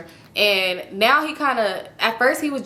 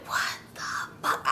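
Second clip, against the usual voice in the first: breathless stunned whisper; half-mouthed in disbelief; whispered what the fuck; 11 dB quieter, much slower than usual. Retold or imitated. To herself, seeing him with the ex: stunned whisper. Stunned inner reaction to boyfriend.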